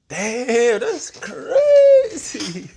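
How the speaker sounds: loud, soaring, drawn-out disbelief; high, loud and stunned; amazed outburst. Real, not acted. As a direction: cry out in astonishment, stretching the last word. Amazed on hearing the accent.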